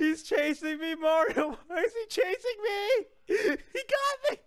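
high-pitched squeal, climbing as it goes; rising gamer panic with nervous laughter; panicked. Real, not acted. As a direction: wail it while being chased, half laughing. Being chased in game.

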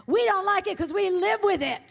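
loud, high holler from the back; full-voiced retold yell. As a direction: imitate a heckler shouting across the hall. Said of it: "woman from the back yelled out".

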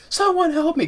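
sudden high mock scream; imitated scream. Quoting the hero at the hospital, a high mock scream. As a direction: yelp it high for help. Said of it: "the way he screams like that".

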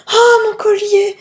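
high, loud, slow overjoyed cry; overcome. A narrator's reading. Wife gets her stolen necklace back, overcome. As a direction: gasp it out, overwhelmed with relief. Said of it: "balbutia-t-elle en le plaquant".

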